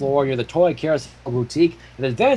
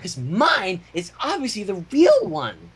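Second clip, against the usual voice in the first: high Pinkie voice; 6 semitones higher than usual. Acted.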